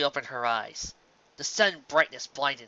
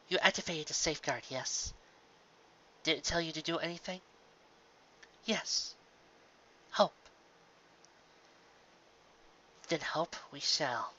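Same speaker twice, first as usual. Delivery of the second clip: quiet; 3 semitones higher, 7 dB quieter than usual. Acted. Celestia soft.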